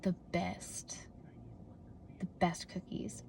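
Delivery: hushed whisper of delight; whispered the best cookies. Real, not acted. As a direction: breathe it like a secret treat.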